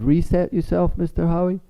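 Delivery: put-on high kid voice; pupil's advice. Pupil telling the teacher to reset, high and chirpy. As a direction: pipe up like a helpful pupil. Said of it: "He said".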